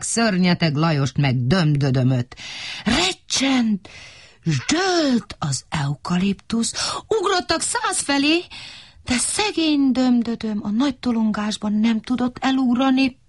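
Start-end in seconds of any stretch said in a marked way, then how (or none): none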